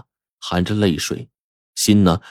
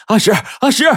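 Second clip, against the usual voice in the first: high-pitched excited call; 14 semitones higher than usual. A narrator's reading. Young man running to his friend in the hills, calling out. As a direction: shout his name while running toward him. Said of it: "一边跑一边喊".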